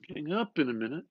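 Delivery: McCoy hurrying his story along, quick; a touch of McCoy, quick. Acted.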